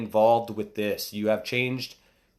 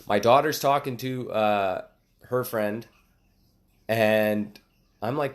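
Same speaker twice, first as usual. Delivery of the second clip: ordinary delivery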